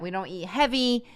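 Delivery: usual speaking voice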